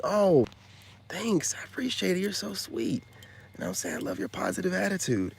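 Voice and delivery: high mock-polite voice; mock sweet reply. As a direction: gush politely, playing it sweet.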